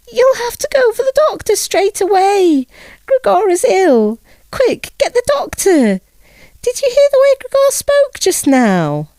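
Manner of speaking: put-on very high, pressing young woman's voice; urgent sister. A narrator's reading. Sister urges her mother to fetch a doctor, high and pressing. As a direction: urge her mother in a hurry.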